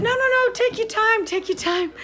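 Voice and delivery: high, loud easy reassurance; reassuring neighbors. Retold or imitated. Neighbors telling Sue to take her time, high reassurance.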